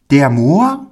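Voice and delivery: high, rising astonished question; highly astonished. A narrator's reading. Pauli hears the man has children, astonished high child voice. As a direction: ask it in a small boy's amazement. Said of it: "fragte Pauli höchst erstaunt".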